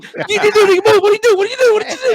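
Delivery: high, loud, laughing panic; mock-panicked shrieking at sister, laughing. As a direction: squeal it in laughing alarm.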